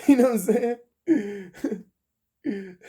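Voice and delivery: high laugh through words. Real, not acted. Laughing punchline.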